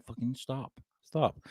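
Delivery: very soft, almost under the breath; quoted quiet command. Retold or imitated. Host quoting himself as Alex said, hushed little command. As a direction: repeat the order in a tiny voice. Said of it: "Alex said".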